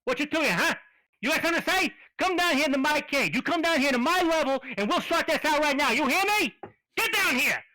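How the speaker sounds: high, loud wiseguy raccoon picking a fight; angry tough-guy raccoon. Acted. Raccoon in a cage picks a fight with the party, loud and high. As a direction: bark at them like a caged street tough.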